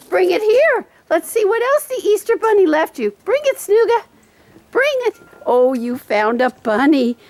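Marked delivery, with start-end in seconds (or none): 0.1-5.2 very high, sing-song cheering for the dog; excited dog talk. Real, not acted. Owner urging her dog to hunt Easter treats, very high excited voice. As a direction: egg the dog on in a high, happy voice. Coaxing dog to bring toy.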